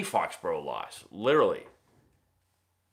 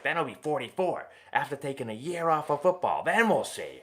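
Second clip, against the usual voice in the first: mimicking wife; 5 semitones higher than usual. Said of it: "She's like".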